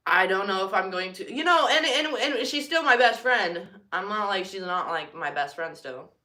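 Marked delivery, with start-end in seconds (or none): none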